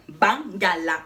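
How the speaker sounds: bangala said slowly, low, each syllable ba-nga-la clear; careful pronunciation. Shown as a demonstration. Teaching viewers to say bangala, slow and clear. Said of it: "prononcez bien".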